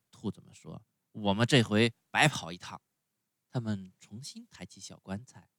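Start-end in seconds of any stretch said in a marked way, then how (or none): 1.1-2.8 louder, disgruntled rabbit voices; grumbling rabbits. A narrator's reading. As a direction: grumble off disappointed. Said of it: "叽里咕噜地说着".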